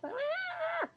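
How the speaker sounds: a very high, breathless mock squeal; mock shriek. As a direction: screech like an overwrought speaker. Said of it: "she's just like".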